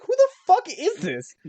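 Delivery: baffled outburst. Retold or imitated. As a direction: squeak it out in total disbelief. Imitating confused driver in video.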